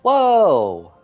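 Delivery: ordinary delivery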